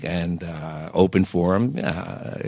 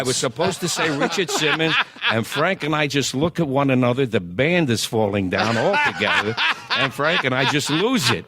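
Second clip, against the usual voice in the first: talking through laughter, higher; laughing; 7 semitones higher than usual. Real, not acted. Singer laughing while recalling the Nixon slip on stage. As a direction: keep laughing as you tell it.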